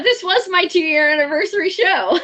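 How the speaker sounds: words breaking into giddy laughter; real laughter bubbling through the words; laughing through it. Real, not acted. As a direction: laugh while you say it, a bit choked up. Gushing about anniversary milestone.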